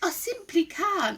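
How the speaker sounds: high character voice pleading inability; reluctant young dinosaur. A narrator's reading. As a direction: refuse in a small, high voice. Story character refusing, high voice.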